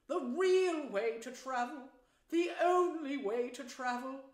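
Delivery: loud, slow, swooning rapture; ecstatic Toad. Acted. Toad raves about the only way to travel, ecstatic. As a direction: stretch the words out in bliss.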